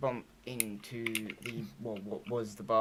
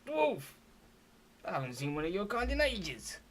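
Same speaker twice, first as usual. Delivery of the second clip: higher-pitched, startled innkeeper voice; surprised barkeep; 7 semitones higher than usual. Acted. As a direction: exclaim at an unexpected customer. Game master plays startled barkeep.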